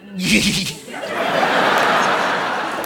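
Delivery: breathy imitated giggle of the friend. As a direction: giggle at nothing.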